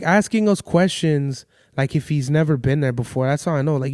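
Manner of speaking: ordinary delivery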